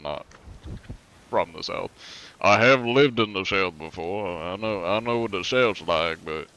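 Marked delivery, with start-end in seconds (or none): 2.4-6.5 put-on Southern American drawl. Shown as a demonstration. Streamer telling chat they lived in the South, Southern drawl. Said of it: "I can do the Southern drawl".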